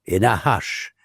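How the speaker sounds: ordinary delivery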